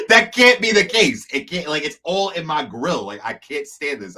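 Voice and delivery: high, loud, exasperated protest; exasperated outburst. Retold or imitated. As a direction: blurt it out high and fed up, almost laughing. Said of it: "I was like".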